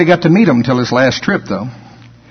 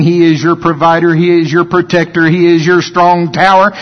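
normal talking voice

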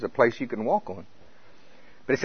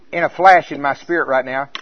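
ordinary talk

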